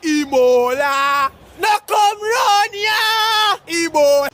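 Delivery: high, loud, slow panicked yelling; panicked shout. Acted. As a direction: scream the warning to keep people away. Shouting warning about Ebola.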